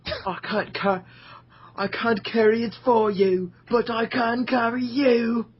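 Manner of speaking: put-on a clumsy New Zealand accent as a film quote; attempted New Zealand accent. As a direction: do a lightly voiced Kiwi Sam quoting Lord of the Rings. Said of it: "the only thing I can think of is Lord of the Rings".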